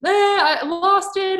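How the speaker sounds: high, slow hammed-up meltdown; lightly mocking her own old outburst; mock freak-out. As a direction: play at losing it. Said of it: "instead of getting mad and freaking out".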